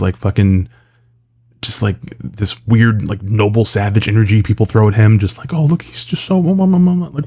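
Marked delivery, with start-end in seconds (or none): 5.5-7.3 high, babying imitation of fawning fans; mock cooing. Mimicking people fawning over Brendan Fraser, mock cooing. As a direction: coo mockingly in a raised voice, trailing into babble.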